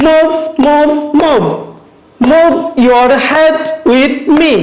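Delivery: "move", "head" and "me" hit as slow beats; slow, spaced words, punching "move", "head", "me"; stressed rhyme words. Shown as a demonstration. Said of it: "যে শব্দগুলোতে শুধুমাত্র আমরা stress রয়েছে".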